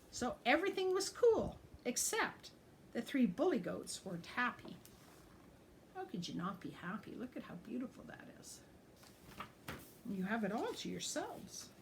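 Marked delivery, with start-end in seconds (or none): none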